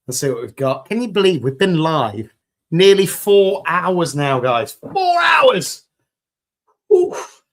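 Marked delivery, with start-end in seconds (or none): 4.7-7.4 amazed. Real, not acted. Marvelling at four hours live.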